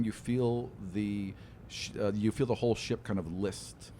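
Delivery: ordinary talk, nothing marked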